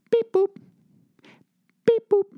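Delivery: mock crosswalk beep. Imitates walk button sound.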